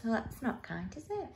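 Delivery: very soft, confiding comment; hushed aside. A narrator's reading. Reader to children about unkindness, hushed. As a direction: drop the voice right down.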